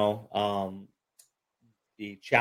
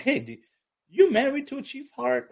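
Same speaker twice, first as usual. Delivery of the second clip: surprised question; 5 dB louder than usual. Retold or imitated. Guest retelling how he asked the host, high surprised question. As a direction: pitch leaps way up on Chief Hart. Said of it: "I'm like".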